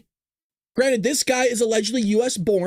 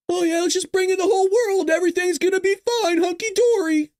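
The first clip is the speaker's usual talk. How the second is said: mocking voice; 8 semitones higher than usual. Mocking open-borders supporters.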